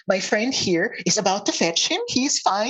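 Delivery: acted cheerful cover-up; insistent reassurance. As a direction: assure the crowd, voice lifted. Centaur reassures crowd about Ander.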